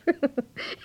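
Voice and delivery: mother chuckling; amused laugh. Acted. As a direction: laugh at the idea.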